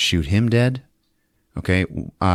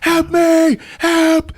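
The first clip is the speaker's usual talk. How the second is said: very high, loud mock screams; hammed-up cry for the guards; screaming for help; 6 dB louder, much slower than usual. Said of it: "He starts screaming to the Japanese guards".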